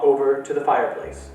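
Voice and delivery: ordinary delivery, nothing marked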